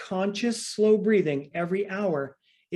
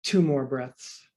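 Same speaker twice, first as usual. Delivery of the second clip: guided breathing count; 4 semitones lower, much slower than usual. Acted. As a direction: count the breaths slowly to calm them. Coach counts breathing for class.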